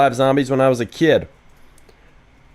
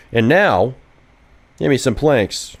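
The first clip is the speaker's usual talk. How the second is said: ordinary delivery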